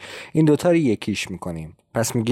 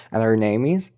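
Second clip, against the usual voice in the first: "and her name is" run together, h dropped; faster, linked; slower than usual. Shown as a demonstration. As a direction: link "and her" quickly as "an-er". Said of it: "اگه بخوایم سریعتر بگیم". («and her name is» سریع و پیوسته)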